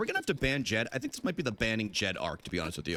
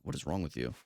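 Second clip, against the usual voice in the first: muttered scolding; 9 semitones lower, 6 dB quieter than usual. Real, not acted. Scolding a game character quietly.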